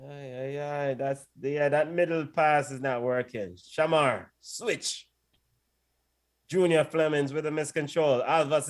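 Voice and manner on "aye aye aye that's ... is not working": ordinary delivery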